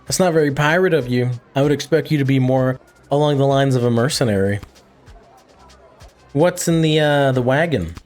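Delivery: ordinary talk, nothing marked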